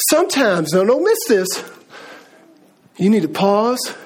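usual speaking voice

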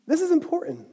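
usual speaking voice

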